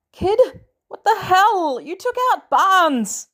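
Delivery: high, loud disbelieving yell; startled exclamation; shocked yell. A narrator's reading. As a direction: shout in disbelief at the kid. Tony shocked at Peter.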